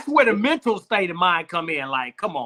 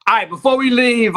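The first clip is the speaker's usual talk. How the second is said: ordinary delivery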